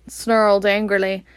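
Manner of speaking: ordinary delivery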